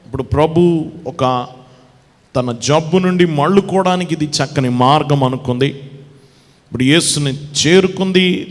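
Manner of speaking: normal talking voice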